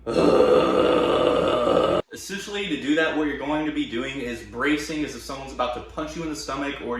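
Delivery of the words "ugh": long low raspy held grunt; held false chord growl. Shown as a demonstration. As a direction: brace and hold the growl. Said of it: "learn to hold it a little bit longer".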